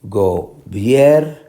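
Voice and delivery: Gobierno in slow pieces, BIER loud and rising; syllable by syllable. Shown as a demonstration. Teacher showing the middle syllable, Gobierno split with BIER stressed. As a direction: break up Gobierno and hit BIER. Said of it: "la del medio".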